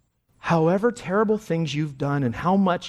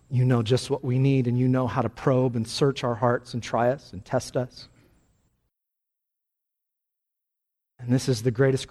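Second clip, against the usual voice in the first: prayer; 4 semitones lower than usual. Acted. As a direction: pray it gently over the congregation. Closing prayer.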